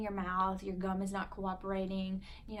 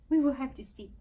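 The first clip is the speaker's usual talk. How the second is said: put-on baby talk; 4 semitones higher, 8 dB louder than usual. Mom cooing to baby.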